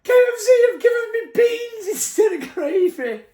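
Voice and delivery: high, wailing mock sob through the words; imitating crying Lydia. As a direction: wail it like Lydia bawling over beans. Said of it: "she's absolutely bawling her eyes out".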